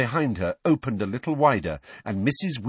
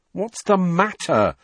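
slow, pointed impatience; impatient; 9 semitones higher, 4 dB louder than usual. A narrator's reading. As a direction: press him for an answer. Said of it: "said Fred impatiently".

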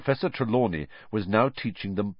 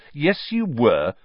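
slow, higher contradiction; insistent; 6 semitones higher, 4 dB louder, slower than usual. A narrator's reading. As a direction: contradict her firmly, drawing it out. Ron bickers with Hermione.

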